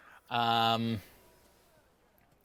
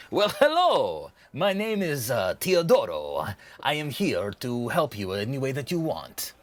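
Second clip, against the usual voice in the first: eager merchant's sales voice; welcoming merchant. Acted. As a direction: greet a customer with a salesman's flourish. Game master voices merchant.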